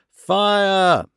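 high-pitched acted alarm cry; yell. Gentlemen repeating the fire alarm, yelling. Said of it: "we all yelled".

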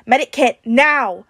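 loud, urgent demand; shouted order. A narrator's reading. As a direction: bark for emergency help. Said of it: "Tony shouted".

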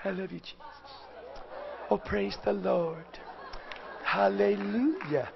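mock a polite little churchgoer cooing praise; tiny murmured praise, mocking the prim ones; prissy quiet worship. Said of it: "that little plastic".

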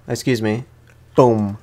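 ordinary talk, nothing marked

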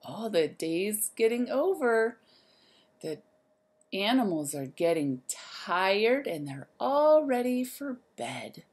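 ordinary delivery

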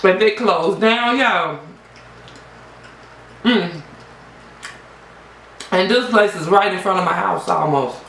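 eating wings between words; talking while chewing. Real, not acted. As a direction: mouth partly full of food. Seen 0: Holds wing pieces at mouth height, lips pursed as if chewing between phrases.

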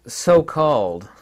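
loud, breaking into a laugh; scornful amusement. Real, not acted. Lecturer mocking so-called scientists, loudly amused. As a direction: let derision tip into laughter.

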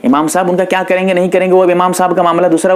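ordinary talk, nothing marked

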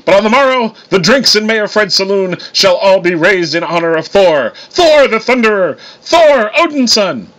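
loud, high, booming proclamation, names drawn out; boastful Thor. A narrator's reading. Thor toasting himself before the saloon, grandly boastful.

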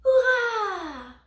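high, loud, drawn-out hurrah; exclaimed cheer. A narrator's reading. Toys bursting out of their box, cheering loudly. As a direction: let out a soaring cheer. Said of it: "Les jouets sortirent de la boîte et s'écrièrent".

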